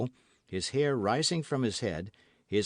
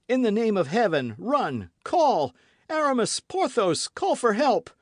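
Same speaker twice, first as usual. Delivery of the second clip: very high, loud commands; frantic shouts; 11 semitones higher, 6 dB louder than usual. A narrator's reading. D'Artagnan ordering Aramis and Porthos, frantic. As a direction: yell at friends to fetch help.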